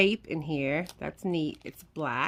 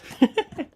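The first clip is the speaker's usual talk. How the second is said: short laugh; 10 semitones higher, 4 dB louder than usual. Real, not acted. Laughing at secret gift.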